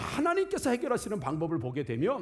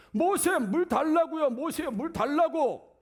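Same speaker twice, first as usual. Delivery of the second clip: slow, higher, insistent demand; demanding crowd; 7 semitones higher, 4 dB louder, slower than usual. Acted. Thirsty Israelites nagging Moses for water, slow and insistent. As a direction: whine it out like thirsty people pestering.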